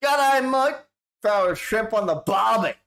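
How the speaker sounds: high, broad mock-Aussie call; hammed-up Australian accent.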